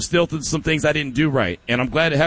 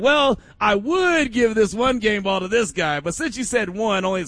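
a higher, slower, sly caller voice; mock a caller bending the rule, higher and slower; 8 semitones higher than usual. Host mimics a crafty caller, higher and slower. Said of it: "someone's gonna try to work the magic and be like".

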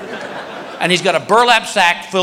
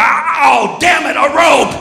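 high, shouted cartoon exasperation; hammed-up yell of dismay; mock frustrated shark; 8 semitones higher, much slower than usual. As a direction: curse like a thwarted shark. Shark thwarted by rope, mocked.

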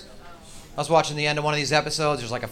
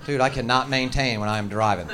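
ordinary talk, nothing marked

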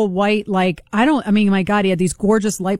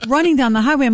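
ordinary talk, nothing marked

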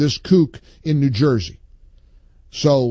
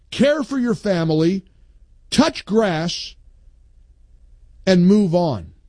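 a raised, slowed, scolding read of a listener's post; emphatic post reading; 5 semitones higher than usual. Acted. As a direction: read it out like a lecture to grown-ups. Reads listener's post aloud.

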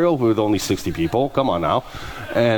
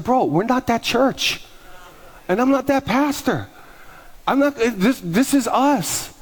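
higher, slow and deliberate; firm declaration; 8 semitones higher, slower than usual. Retold or imitated. Pastor telling the critic this church is not for him, higher and slow. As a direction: lay it down plainly, word by word.

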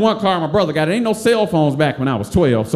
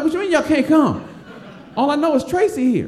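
indignant disbelief; 10 semitones higher than usual. Retold or imitated. Cousins can't come.

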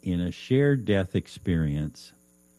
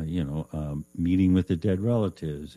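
usual speaking voice

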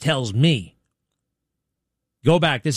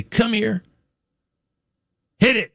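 imitating Alex calling a dog; 9 semitones higher than usual. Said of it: "I think you hear him say".

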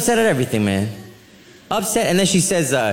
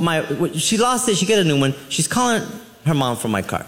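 normal talking voice

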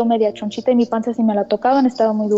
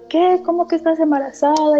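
higher-pitched imitation of disbelief; mock shock, pitched up; shocked sister; 6 semitones higher than usual. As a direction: retell a sister's stunned questioning. Retells sister's shocked reaction to pregnancy news.

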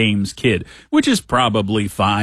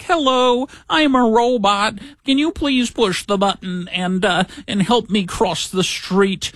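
much higher, slow, synthetic delivery-robot voice; a polite little robot asking for help; 11 semitones higher, slower than usual. Retold or imitated. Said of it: "sending a little message out".

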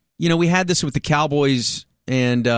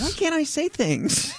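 high-pitched, softer squeal of protest; mock-indignant protest; 12 semitones higher, 6 dB quieter than usual. Real, not acted. Host objects to being shushed by co-host, mock-indignant. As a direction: protest in a much higher voice. Mock squabble over a jinx.

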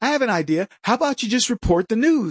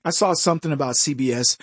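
usual speaking voice

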